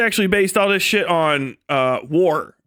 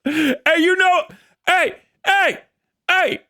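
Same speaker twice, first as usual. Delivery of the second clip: street tough calling out; 8 semitones higher than usual. Pretend. Submarine acted as street tough.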